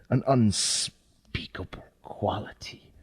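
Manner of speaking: breathy horror-story whisper; hushed whisper. A narrator's reading. Narrator telling a horror tale, whispered. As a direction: let the words drop to a dread whisper.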